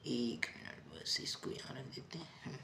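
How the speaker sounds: hushed off-mic aside; whispered aside. Real, not acted. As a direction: whisper to yourself while fixing the board. Aside while arranging board.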